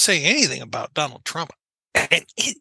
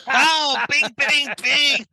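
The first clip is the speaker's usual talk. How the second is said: laughing; 6 semitones higher, much slower than usual. Real, not acted. Laughing after own joke.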